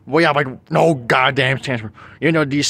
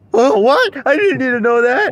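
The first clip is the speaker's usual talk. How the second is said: shocked high voice; 11 semitones higher, 4 dB louder than usual. Acted.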